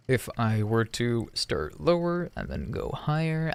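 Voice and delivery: speaking low before a pitch ladder; starting low. Shown as a demonstration. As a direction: begin in a low register, ready to climb. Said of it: "Let's try to increase the pitch".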